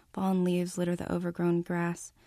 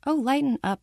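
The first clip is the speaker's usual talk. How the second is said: high, scoffing retort; dismissive; 7 semitones higher, 4 dB louder than usual. Acted. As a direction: brush her off.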